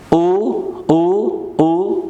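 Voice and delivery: "او" drawn out as a rounded vowel, gliding upward; pronunciation drill. Shown as a demonstration. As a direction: repeat "او" slowly in isolation with a rising tune.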